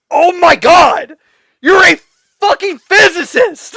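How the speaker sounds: high, slow, loud disbelieving yell; shouting in disbelief at a character on screen; exasperated shout at the screen. Retold or imitated. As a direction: yell it at the TV like she can hear you. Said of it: "I was like".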